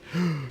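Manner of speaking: sharp intake of breath; mock panicked gasp. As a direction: gasp like an alarmed mum. Acting overprotective mum's panic.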